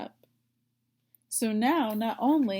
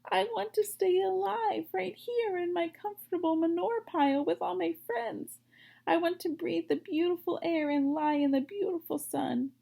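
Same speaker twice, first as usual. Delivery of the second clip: whining piglet; 8 semitones higher than usual. Acted. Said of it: "he moaned".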